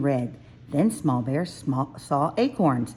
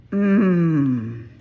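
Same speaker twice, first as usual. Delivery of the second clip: contented mmm; 6 dB louder than usual. A narrator's reading. Bear rubbing nose in wood.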